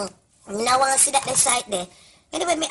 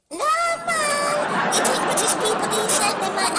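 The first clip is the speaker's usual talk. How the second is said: high shout; 12 semitones higher than usual. Acted. Teasing Hillary about British people, high squealing shout. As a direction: squeal it much higher.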